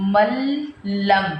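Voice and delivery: "मल्लम" stretched on a doubled l, no n; noon merged into lam. Shown as a demonstration. Teacher repeating the reading without noon, long l in "मल्लम". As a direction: glide straight from "ma" into a long l in "मल्लम". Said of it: "नून को हमने इसमें नहीं पढ़ना".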